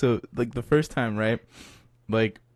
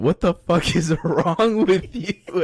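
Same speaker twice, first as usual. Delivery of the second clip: high, loud, drawn-out scolding through laughter; stretched-out exasperated retort, half laughing; amused mock outrage; 6 semitones higher, 5 dB louder, slower than usual. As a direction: scold a friend in laughing disbelief. Acted telling-off of friend, amused.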